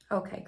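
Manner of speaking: breathy unvoiced sigh before giving in; resigned sigh. Real, not acted. Answering God, a resigned sigh. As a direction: let it out on a tired sigh.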